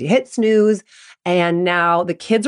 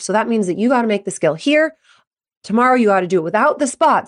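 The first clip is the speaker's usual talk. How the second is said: brisk, nagging run of instructions; pressuring parent; faster than usual. Acted. Mom answering venting kid with solutions, pushy. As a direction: rattle off orders, leaving no gap.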